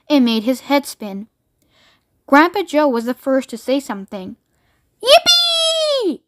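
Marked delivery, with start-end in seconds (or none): 5.0-6.2 high, whooping yippee; long high-pitched celebratory whoop; joyful yell. A narrator's reading. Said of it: "he yelled out".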